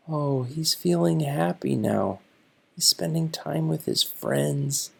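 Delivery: usual speaking voice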